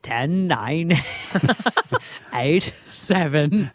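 put-on halting, half-hearted stab at an Arnie accent; lightly attempted Arnie countdown accent. Radio host reluctantly imitating Arnie's countdown, unconvincing accent. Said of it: "I can't do Arnie's accent".

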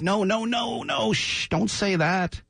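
high-pitched imitation of alarmed hushing; mock panicked shushing. Team staff stopping new boss's candor, mock panicked shushing. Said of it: "I'm sure everybody was like".

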